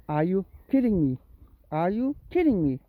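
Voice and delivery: disbelieving, punched on "kidding"; exasperated. Real, not acted. Owner fed up with the cat in darkness, exasperated. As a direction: protest with a falling end.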